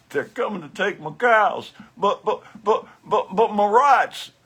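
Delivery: laughing, mock Southern farmer's protest; Southern accent. Mimicking the Southern man's accent for his audience, laughing. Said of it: "he just did a Southern accent thing".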